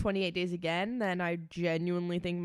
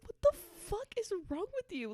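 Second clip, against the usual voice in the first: high-pitched, incredulous retold reproach; mock self-scolding; 12 semitones higher, 4 dB quieter than usual. Retells scolding herself for tearing up.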